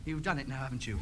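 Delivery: low, soft, deflated voice; crushed reproach. Acted. Radio ham to husband who wrecked the set, low crushed reproach. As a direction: sink into quiet reproach.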